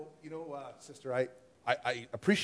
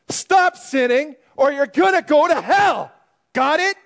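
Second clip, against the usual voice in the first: shouted warning; 11 semitones higher, 12 dB louder than usual. Acted. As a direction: bellow the warning at sinners. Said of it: "you need to say".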